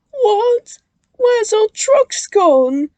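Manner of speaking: high, loud shocked gasp. A narrator's reading. Twins finding their trucks gone, gasping loudly. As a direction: gasp out in alarm. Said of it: "they gasped".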